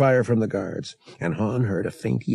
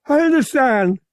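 very high, loud creature voice; Murg's character voice; 8 dB louder than usual. Acted. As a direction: answer in a high, forceful alien voice. Murg answers in character voice.

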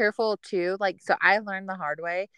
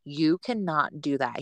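slow, lower, firm reprimand; retold scolding; 5 semitones lower, slower than usual. Husband scolding wife for alarming calls, slow and stern. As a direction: lay down the rule slowly. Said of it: "I got yelled at".

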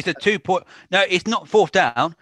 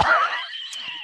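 airy squeal of laughter; breathy high laugh; 4 dB quieter than usual. Real, not acted.

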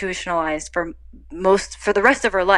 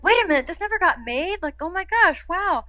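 mock amazement; 11 semitones higher than usual. Retelling surprise at unmade script.

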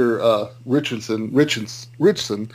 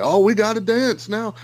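high, excited mock exclamation; put-on eager cry, pitched way up; mock trope character; 9 semitones higher, 3 dB louder than usual. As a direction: blurt it out louder and much higher, mocking the cliché. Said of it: "they're like".

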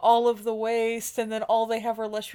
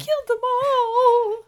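higher, louder, slow drawn-out yell; mock scream; 10 semitones higher, 6 dB louder, much slower than usual. Friend joining in screaming at the captain. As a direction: wail it out dramatically.

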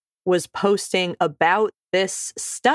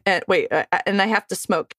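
ordinary delivery

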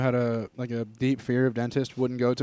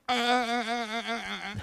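mock seizure stammer; 9 semitones higher, 7 dB quieter than usual. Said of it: "they just start having a seizure".

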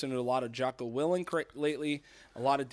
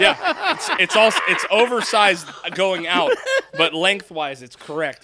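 indignant loud protest; 3 semitones higher, 9 dB louder than usual. Real, not acted. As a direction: defend his torso, loudly. Indignant laughing defense.

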